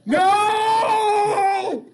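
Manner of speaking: long, high, piercing "no!"; imitated screaming protest. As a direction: scream a drawn-out mock refusal. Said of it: "I'll do what Marshall does".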